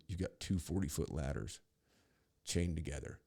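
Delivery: ordinary talk, nothing marked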